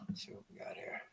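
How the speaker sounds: unvoiced muttered whisper; whispered aside while fiddling. Real, not acted. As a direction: breathe it to yourself.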